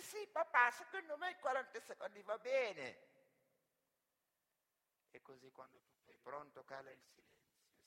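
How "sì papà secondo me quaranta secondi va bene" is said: put-on high, excited little boy's voice; eager child. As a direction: pipe up to daddy with your guess. Child answers father while betting.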